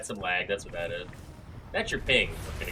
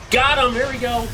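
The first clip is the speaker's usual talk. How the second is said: excited shout; 14 semitones higher, 14 dB louder than usual. Real, not acted. Scoring a kill.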